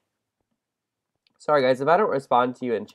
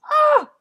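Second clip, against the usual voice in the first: piercing high shriek; startled scream; 9 dB louder than usual. Real, not acted. Jumping across a gap, high startled shriek. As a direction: let out a sudden squeal of fright. Jump in parkour game.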